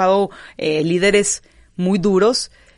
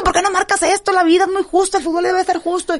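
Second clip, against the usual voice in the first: much higher, louder, indignant retelling of a complaint; mock protest to referee; 11 semitones higher, 3 dB louder than usual. Impulsive player complaining to the referee, high indignant protest. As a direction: replay yourself storming up to the ref. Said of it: "Y ahí voy con el árbitro".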